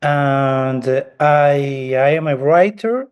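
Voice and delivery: normal talking voice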